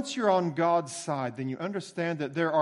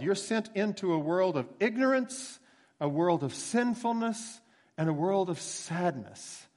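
normal talking voice

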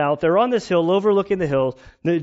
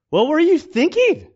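scolding lifeguard; 11 semitones higher, 5 dB louder than usual. Retold or imitated. Lifeguard scolding drowning person.

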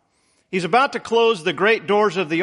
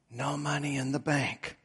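faint, hoarse and much softer; weak raspy voice; 4 semitones lower, 10 dB quieter than usual. A narrator's reading. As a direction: barely get the words out, rasping. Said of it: "in a weak, raspy voice".

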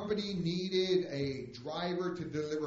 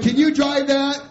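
high, booming retold question; loud challenge; 11 semitones higher, 14 dB louder than usual. Boss asks new driver about the big truck, loud challenge. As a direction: imitate a boss loudly challenging.

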